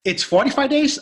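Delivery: high-pitched incredulous question. Retold or imitated. Challenging the gym employee on cancellation days, incredulous.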